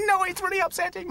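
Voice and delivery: high-pitched mock tearful wail; hammed-up distress in a very high voice; imitating upset wife. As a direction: put on a squeaky, upset protest. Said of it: "she got so upset at me".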